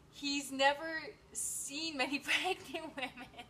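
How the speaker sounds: slow, loud, breaking into laughter; words stretched by real laughter; cracking up. Real, not acted. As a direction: read it while losing it laughing. Laughingly reads viewer's teasing comment.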